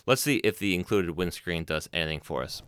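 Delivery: ordinary talk, nothing marked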